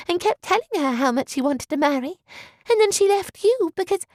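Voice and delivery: teasing pretend outrage; faux angry. A narrator's reading. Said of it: "a faux angry tone".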